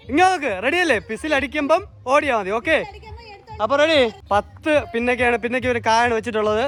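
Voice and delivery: ordinary talk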